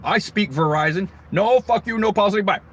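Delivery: loud, high brush-off; hammed-up curt call-center agent; mock telemarketer. Mocking the telemarketer who hangs up on callers, loud and curt.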